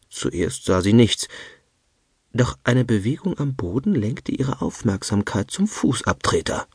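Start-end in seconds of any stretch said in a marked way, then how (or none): none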